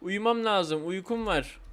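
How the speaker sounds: normal talking voice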